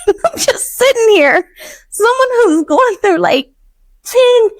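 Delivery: real emotion breaking the voice; laughing through tears. Real, not acted. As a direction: choke up while laughing. Overcome retelling her kindness.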